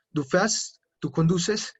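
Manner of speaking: ordinary talk, nothing marked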